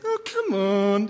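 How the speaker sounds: drawn-out, mock whine; whiny protest. Kid objecting to crossed toes, slow put-on whine. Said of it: "The whiny voice".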